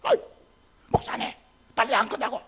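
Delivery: mock annoyance. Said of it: "그때부터 화를 내". (목사에게 짜증 내는 흉내)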